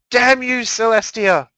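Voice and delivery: high, loud cursing scream; furious shout. Acted. Mare screaming curses at Celestia, loud and high. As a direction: curse her sister at full voice. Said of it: "she shouted".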